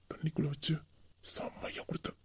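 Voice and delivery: breathy, delighted whispering; hushed, savouring whisper; intimate whisper. Acted. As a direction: confide it secretly to a lover. Savouring a stolen kiss, intimately.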